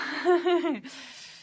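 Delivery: a high, bright giggle; host giggling before moving on. Real, not acted. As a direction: laugh it off lightly. Laughing mid-stream.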